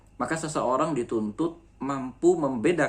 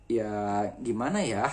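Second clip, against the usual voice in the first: Sundanese lilt, slow, the final ya breathed out with an h; Sundanese ending; slower than usual. Shown as a demonstration. Teacher demonstrating Sundanese speech to the class. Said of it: "orang Sunda ... bukan glotal ... tapi huruf H".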